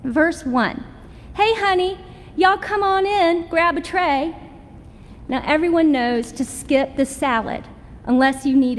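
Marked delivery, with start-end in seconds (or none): 1.3-4.3 high, sing-song Southern welcome from a cafeteria server; Southern cafeteria lady. Retold or imitated. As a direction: greet them like a cheery Southern lunch lady, pitched up high. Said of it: "greet you with a chorus of other voices with accents".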